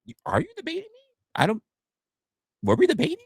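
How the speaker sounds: high, soft puzzled question; mock confusion. Streamer acting confused at the chatter. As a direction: sound lost and baffled.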